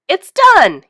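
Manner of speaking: loud triumphant yell, pitched high; shouted. A narrator's reading. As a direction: shout it out proudly. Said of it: "he shouted".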